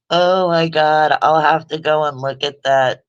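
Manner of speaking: amused exclamation. Real, not acted. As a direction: burst out in delight. Amused by a chat comment.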